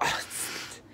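normal talking voice

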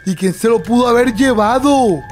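ordinary talk

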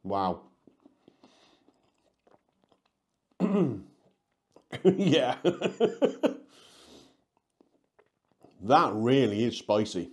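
speaking between bites; chewing while talking. Real, not acted. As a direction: mouth partly full of food. Seen 0: Bit slice at -7; lips pursed, chewing-like mouth, holding pizza throughout.

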